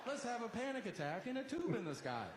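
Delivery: high, fast mock cheer; sarcastic cheer. To himself boarding a plane, high sarcastic cheer. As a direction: fake excitement about a panic flight.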